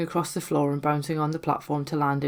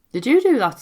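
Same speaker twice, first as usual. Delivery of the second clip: startled; 9 semitones higher, 7 dB louder than usual. Acted. Startled by the moving planchette.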